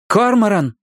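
firm, high warning call. A narrator's reading. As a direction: stop him with one word. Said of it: "твёрдо сказала Робин".

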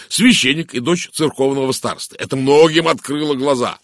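loud, animated gossip; emphatic, blustering talker; Blatt character voice. A narrator's reading. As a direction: hold forth with scornful relish.

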